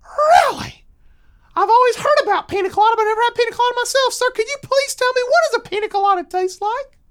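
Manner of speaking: put-on high, loud excited kid; squeaky child burst, breakneck pace; very enthusiastic. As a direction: gush at top speed like a thrilled little boy. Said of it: "he responds very enthusiastically saying".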